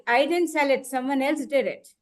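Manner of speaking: normal talking voice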